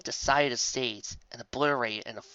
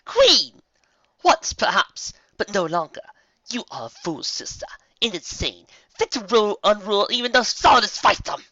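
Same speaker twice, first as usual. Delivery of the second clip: high, loud mocking taunt; sneering villain; scornful dark queen; 10 semitones higher, 11 dB louder than usual. A narrator's reading. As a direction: belittle your sister. Dark queen mocks sister.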